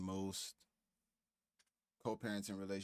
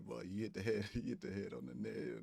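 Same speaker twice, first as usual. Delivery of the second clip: normal talking voice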